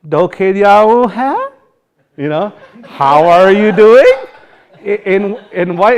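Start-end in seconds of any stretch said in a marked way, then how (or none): 0.1-2.2 "Doked yaun he" dragged out high and loud, rising like an English question; slow mock-English question tune on "Doked yaun he"; Washichu-style question. Shown as a demonstration. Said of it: "sometimes they'll do it, Washichu se". 2.8-4.1 "How are you doing" loud, slow, climbing high at the end; mock Washichu question tune on "How are you doing"; high rising question. Shown as a demonstration. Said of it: "they go high at the end".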